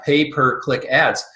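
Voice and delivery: deliberately slow "pay per click ads", words kept apart; slow and distinct. Real, not acted. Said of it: "I try to say that slowly".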